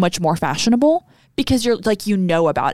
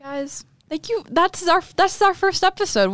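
bright high sign-off; excited; 10 semitones higher than usual. Real, not acted. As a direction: cheer out the ending.

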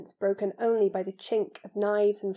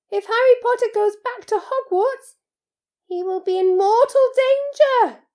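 very high, loud elf voice; Dobby, frantic warning; 11 dB louder than usual. Acted. House-elf insists Harry stay safe, frantic. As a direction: cry out the danger, squeaky and urgent.

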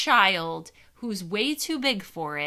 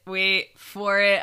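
louder teasing wait; louder build-up; 6 dB louder than usual. Real, not acted. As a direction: push it out louder.

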